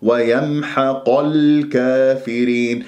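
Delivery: slow, joined ويمحق الكافرين with long vowels; measured tajweed model of ويمحق الكافرين; correct recitation. Shown as a demonstration. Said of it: "خطأ، ويمحق".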